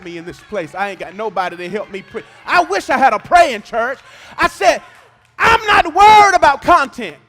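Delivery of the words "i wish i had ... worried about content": thundering preaching. Acted. Climactic sermon shout.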